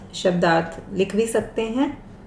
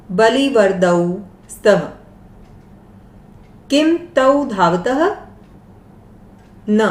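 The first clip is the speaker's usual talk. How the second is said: slow, deliberate recitation of the Sanskrit text; lesson text reading; 2 semitones higher than usual. Shown as a demonstration. Teacher reading the Sanskrit lesson aloud to students. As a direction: read each word out clearly for learners.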